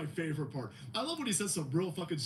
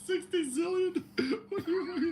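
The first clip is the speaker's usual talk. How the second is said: high laughing question; laughing disbelief; 11 semitones higher than usual. Real, not acted.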